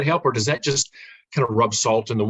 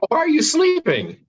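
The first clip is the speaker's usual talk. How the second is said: high-pitched teasing question; playful calling out. Pretend. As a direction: call someone out playfully, voice jumping high. Mock scolding of a sleeping colleague.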